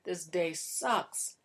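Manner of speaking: slow, dragged-out grumble; drawn-out, half-playful complaint; mock cranky groan. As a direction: play the worn-out wife after a lousy day. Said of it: "you're cranky. You're like".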